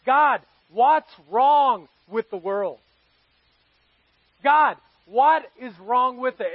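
loud, slow cry out to God; anguished plea to God. Retold or imitated. Pastor crying out to God after a friend's loss, loud slow lament. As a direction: lament to heaven, drawn out and loud. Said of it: "I'm thinking this question".

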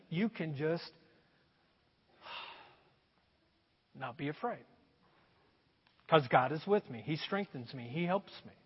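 low, soft, sighing comfort; a quiet sigh of relief, then calm; softly reassuring. Real, not acted. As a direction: let the fear go out of the words. Comforting after fear verses.

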